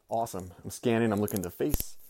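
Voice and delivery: ordinary talk, nothing marked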